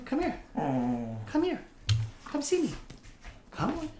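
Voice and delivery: high, soft dog-calling voice. Real, not acted. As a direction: coax the puppy to come.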